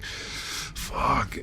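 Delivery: hushed, breath-only swear word; whispered curse. Retold or imitated. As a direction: curse under your breath, voiceless. Acts out his shaken thought.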